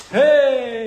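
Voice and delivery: very high, very loud cheerful hey; enthusiastic greeting. Retold or imitated. Taiwanese locals greeting an American, delighted shout. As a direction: greet a long-lost friend. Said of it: "it's like".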